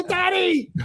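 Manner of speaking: put-on squeaky high little girl's "Daddy"; child voice. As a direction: lift way up into a small girl's voice. Voicing daughter calling him.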